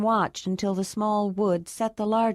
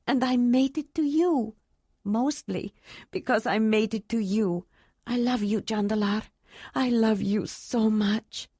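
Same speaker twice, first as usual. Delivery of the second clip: higher, tearful joy, slowing at the end; tearful woman's voice; 4 semitones higher than usual. A narrator's reading. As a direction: pour it out through happy tears. Ayla's tearful joyful speech.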